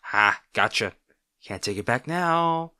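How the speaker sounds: loud, high gloating laugh-line; loud teasing triumph; triumphant gotcha. Acted. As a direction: crow over catching them out. Teasing catches listener's admission.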